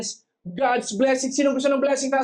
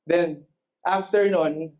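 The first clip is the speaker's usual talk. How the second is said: ordinary talk, nothing marked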